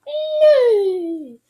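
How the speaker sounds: loud, high horse whinny; whinny shrilly like a horse; horse neigh. A narrator's reading. Boy showing friends his horse, high neigh. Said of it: "I heard Joey run out on horseback".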